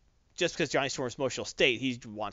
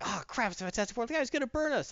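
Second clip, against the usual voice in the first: mock panic; 10 semitones higher than usual. Scared punks quote.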